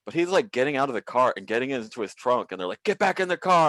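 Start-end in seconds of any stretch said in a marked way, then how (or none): 2.8-3.7 a barked police order, louder and higher; cop yelling. Retold or imitated. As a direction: order him back in the car. Cops yelling at the driver.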